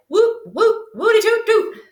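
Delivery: high, loud "whoop, whoop"; whooping cheer. Acted. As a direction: whoop like a happy crowd. Rodents cheering.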